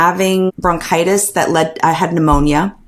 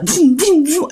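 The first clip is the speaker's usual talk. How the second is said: high playful vroom vroom engine sound; car engine noise; 11 semitones higher than usual. Retold or imitated. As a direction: mimic a race car revving, higher pitch. Imitating husband's speeding car.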